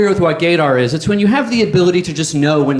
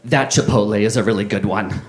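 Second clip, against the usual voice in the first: lower-pitched, lightly mocking imitation of a Manhattan woman; a hint of a bored city girl, lower; overheard woman's voice; 8 semitones lower, 4 dB quieter than usual. Comic imitating an overheard woman to the crowd, lower mock voice. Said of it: "I overheard this in this voice".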